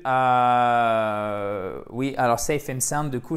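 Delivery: normal talking voice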